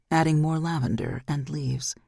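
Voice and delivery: ordinary delivery, nothing marked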